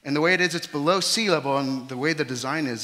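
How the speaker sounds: loud, high preaching; preaching at full force. Acted. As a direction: preach it out to the hall.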